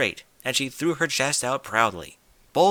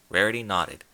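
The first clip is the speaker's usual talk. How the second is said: usual speaking voice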